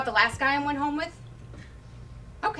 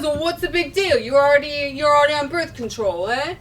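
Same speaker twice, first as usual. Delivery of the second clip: arguing; 2 semitones higher, 6 dB louder than usual. Acted. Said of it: "he starts arguing with me".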